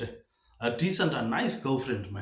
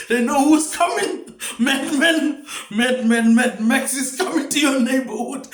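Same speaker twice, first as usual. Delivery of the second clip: high, loud words breaking into laughter; helpless laughing; 7 semitones higher, 4 dB louder than usual. Real, not acted. Comic announcing Mad Max to the neighborhood, laughing hard. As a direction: struggle to get the warning out while cracking up. Cracks up over madman warning.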